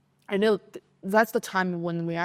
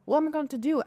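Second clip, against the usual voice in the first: higher, mock-panicked cry; panicked classmates; 8 semitones higher, faster than usual. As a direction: voice the whole class in a flap. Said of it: "classroom is so, like, exploded".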